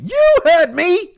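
very high, loud, slow shrieking sitcom auntie; hollered, stretched-out bad Lawanda Page; Lawanda Page impression. Radio host takes a call from Lawanda, high slow shrieked impression. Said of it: "That was my Lawanda Page impression".

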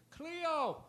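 high mock shout from the back; distant yell. Fan in the back yells the dog's name, shouted. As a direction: call the dog's name across a hall. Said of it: "somebody in the back just goes".

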